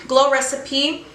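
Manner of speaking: normal talking voice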